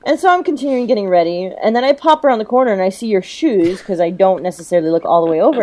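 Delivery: usual speaking voice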